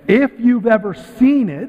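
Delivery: IF drawn out high and loud; stress on IF. Real, not acted. Speaker to audience about American Idol, stress on IF. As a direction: lean hard on the word If. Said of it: "and I say if".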